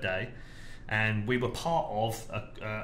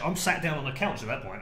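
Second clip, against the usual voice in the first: ordinary talk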